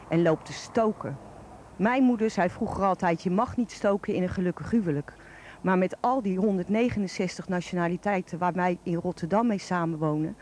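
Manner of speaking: ordinary delivery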